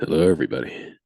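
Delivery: low, big show-opening greeting; announcer voice. Pretend. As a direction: welcome the crowd like an announcer. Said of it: "Announcer voice?".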